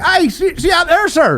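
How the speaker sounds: high-pitched mock farmer boast; excitable caricature farmer voice; Irish farmer. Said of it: "the guy's a fucking millionaire and he's like".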